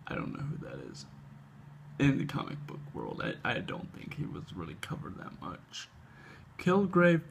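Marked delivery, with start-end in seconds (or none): 0.1-5.9 low whispered aside, fast; muttered aside. Real, not acted. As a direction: whisper it under your breath.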